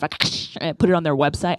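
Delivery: normal talking voice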